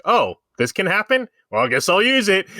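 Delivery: high, flippant mock Palpatine; lightly voiced careless Palpatine. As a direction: toss it off like he just shrugged. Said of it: "how Palpatine was like".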